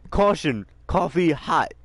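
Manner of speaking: high, loud label read; mocking a coffee warning label. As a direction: announce it like a silly sign.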